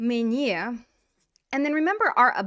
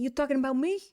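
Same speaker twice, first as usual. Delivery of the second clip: tough-talk line in a New Jersey accent; 4 semitones higher, faster than usual. Shown as a demonstration. Teacher showing students the accented version of the line, New Jersey accent. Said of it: "do it with a New Jersey accent".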